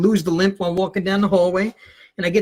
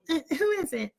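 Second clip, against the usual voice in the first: high, soft squeaky mother; fake mom voice; 10 semitones higher, 7 dB quieter than usual. Teen invents his mother for the teacher, high faraway voice. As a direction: answer as a distant, put-on mom. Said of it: "The voice just came out".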